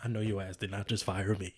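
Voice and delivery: low, soft, menacing disbelief; quiet disbelief. Acted. Father hears he is fired, quietly incredulous. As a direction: say it low and under your breath. First take of acted outrage.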